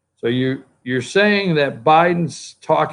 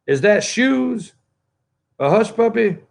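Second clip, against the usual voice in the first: mock clueless candidate; 6 semitones higher than usual. Mocks candidates not knowing hush puppies.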